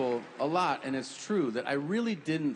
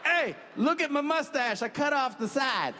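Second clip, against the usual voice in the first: mock Hitler accent; 8 semitones higher than usual. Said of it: "He was like".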